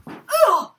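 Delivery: very high, loud staged squeal; a shrieked "Ooh!" as she pretends to tumble; fake fall squeal. Vlogger squeals while faking a fall, theatrical.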